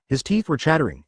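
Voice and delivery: ordinary delivery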